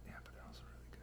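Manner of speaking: soft unvoiced whisper. Real, not acted. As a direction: whisper it under your breath. Whispered reply while eating.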